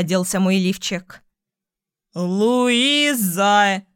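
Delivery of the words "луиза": put-on very slow drunken yell, dragging words. A narrator's reading. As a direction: bellow it drunk, stretching every word. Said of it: "раздалось вновь".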